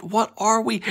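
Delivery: ordinary delivery, nothing marked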